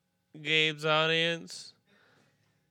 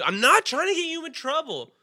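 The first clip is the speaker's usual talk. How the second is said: high-pitched, insistent mock complaint; imitated cop protest; 10 semitones higher, 4 dB louder than usual. Comic retelling a cop's denial, high imitated protest. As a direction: push the voice way up, protesting. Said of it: "Did he say it like that?".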